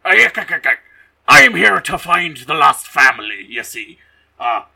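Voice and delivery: loud, hammed-up character voice; Mister Krabs. Lawman arriving to find the lost family, hammed-up character voice. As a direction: put on a big cartoonish announcing voice. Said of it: "Clancy Brown shows up and he's like".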